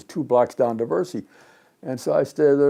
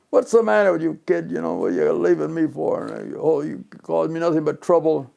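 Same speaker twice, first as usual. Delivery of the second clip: mock scolding, pitched up, louder at the start; imitated angry yelling; 4 semitones higher, 3 dB louder than usual. Boss confronts the employee who quit, imitated yelling. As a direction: act out an irate boss telling off a kid. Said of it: "he's yelling at me out in the street".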